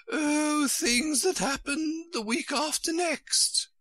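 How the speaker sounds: very high, airy careless queen. A narrator's reading. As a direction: toss it off slowly in a high queenly voice. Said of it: "replied in a careless tone".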